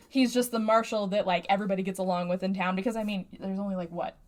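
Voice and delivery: ordinary talk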